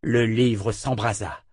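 ordinary delivery

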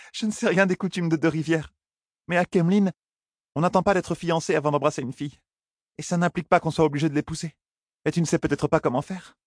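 high-pitched, coaxing heroine; high, playful young woman's voice; teasing young woman. Acted. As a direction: tease him along in a high feminine voice. Heroine teases him into kissing.

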